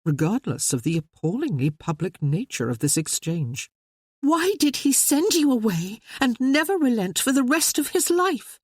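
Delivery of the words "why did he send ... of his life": put-on much higher young lady's voice, pleading; Maria crying out. Sister demanding answers from her brother, high pleading cry.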